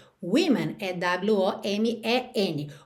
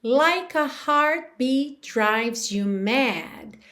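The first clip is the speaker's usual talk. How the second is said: very slow demo; 2 semitones higher, much slower than usual. Shown as a demonstration. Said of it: "teacher reads song line slowly".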